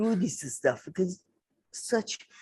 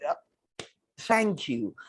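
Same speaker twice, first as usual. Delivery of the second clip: ordinary talk, nothing marked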